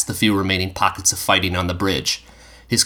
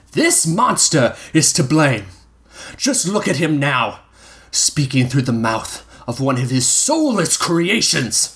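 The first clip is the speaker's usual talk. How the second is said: 1 accusing leader; 7 semitones higher than usual. A narrator's reading. Leader denounces Alphonse.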